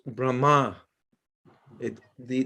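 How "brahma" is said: Brahma with the weight on the final -ma; stress on second syllable. Shown as a demonstration. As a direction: say Brahma as brah-MAA, leaning on the second syllable. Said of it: "the emphasis is on the second syllable".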